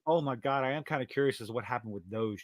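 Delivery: ordinary delivery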